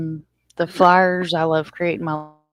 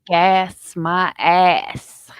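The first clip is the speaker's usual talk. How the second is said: slow quoted line; 4 dB louder, much slower than usual. Retold or imitated. Quoting a line slowly.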